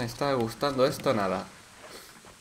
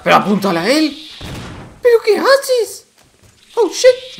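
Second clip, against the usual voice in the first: much higher, much louder panicked screams; higher, louder frantic screams; screaming in panic; 11 dB louder than usual. Real, not acted. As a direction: shriek at the attack in terror. Fighting off an attacker.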